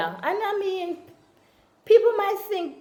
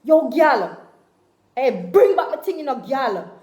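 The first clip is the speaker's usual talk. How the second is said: upset patois outburst; 3 semitones higher, 7 dB louder than usual. Acted. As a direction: yell at her to bring your thing back. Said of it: "when you get upset".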